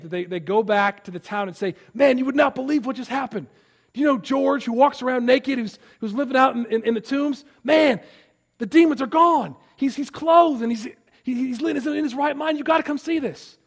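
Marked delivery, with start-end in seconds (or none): 1.9-13.6 high, loud, breathless good-news telling; excited townsfolk gossip. Retold or imitated. Herdsman telling the town the amazing news, excited. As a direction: gush it like the best news in town. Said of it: "I always read it like that".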